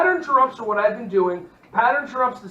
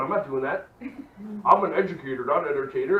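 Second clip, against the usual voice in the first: lightly voiced reluctant teacher, lower pitch; reluctant inner voice; 6 semitones lower, faster than usual. Sceptical teacher talking to self about the advice, mock reluctant. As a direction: drop low for a mock refusal. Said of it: "Some people's voice in your head going".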